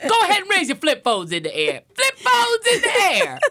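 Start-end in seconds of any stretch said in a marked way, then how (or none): 1.9-3.4 high, slow party call; hype host. Pretend. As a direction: pump up the crowd. Hyping a party crowd.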